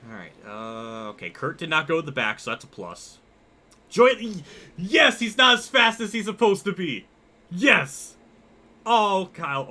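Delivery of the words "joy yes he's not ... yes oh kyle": loud, high-pitched cheer; exultant outburst, much higher and louder; excited shouting. Real, not acted. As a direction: shout it with real glee. Delighted by rivals' race start.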